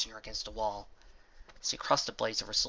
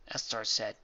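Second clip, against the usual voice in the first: usual speaking voice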